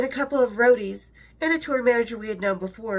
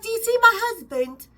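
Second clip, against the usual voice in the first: put-on high English accent, louder; imitating an English accent, pitched up; English lady voice; 10 semitones higher, 5 dB louder than usual. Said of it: "She's like".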